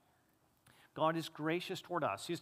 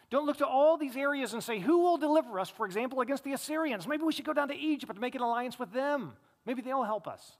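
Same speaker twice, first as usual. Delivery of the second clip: louder and higher, pleading rebuke; impassioned preaching; 7 semitones higher, 5 dB louder than usual. Acted. Asaph urging Israel not to seek allies, louder and higher. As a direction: press the warning with rising fervor.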